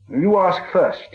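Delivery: high and very slow; stalling hobbit. A narrator's reading. Bilbo buying time before the riddle game, slowly. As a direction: drag it out to buy time.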